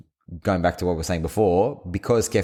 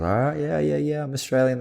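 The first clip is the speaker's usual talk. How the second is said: high-pitched, placating retelling; imitated polite going-along; mock-humouring; 8 semitones higher than usual. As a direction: play along, pitched up, humouring a bad impression. Humours bad impressions of his accent.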